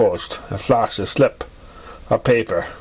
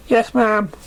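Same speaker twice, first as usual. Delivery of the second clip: much higher, halting nervous voice; flustered young pony; 9 semitones higher than usual. A narrator's reading. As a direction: stammer it out, pitched way up and unsure. Young pegasus answering clerk.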